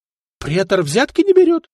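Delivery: high, loud, stressed NE; stunned, nearly shouting; incredulous outcry; shocked disbelief. A narrator's reading. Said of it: "Ставракий удивился так".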